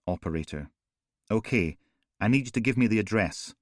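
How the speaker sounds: ordinary delivery, nothing marked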